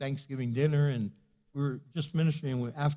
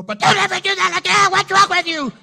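much higher, much louder mock bawling-out; pretend scolding; 14 semitones higher, 12 dB louder than usual. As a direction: yell at the kid the way the mother wanted. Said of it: "I didn't do that. That's what she wanted me to do".

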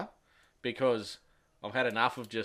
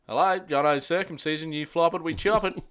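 higher, louder receptionist patter; mock phone greeting; 6 semitones higher, 5 dB louder than usual. Answering a mate's call as a fake business, in a cheesy receptionist voice. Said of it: "I always answer with the old".

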